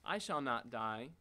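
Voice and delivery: ordinary talk, nothing marked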